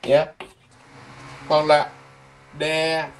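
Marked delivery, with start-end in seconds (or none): 2.6-3.1 "December" as de ... sem ... ber, long gaps; syllable by syllable. Shown as a demonstration. Teacher breaks the month down for students, widely spaced syllables.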